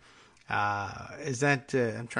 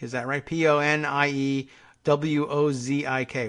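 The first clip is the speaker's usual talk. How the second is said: normal talking voice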